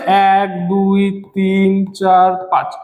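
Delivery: low, level, stretched count; drawn-out counting. Shown as a demonstration. Teacher counting five metres, low and drawn out. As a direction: hold each number on one low note.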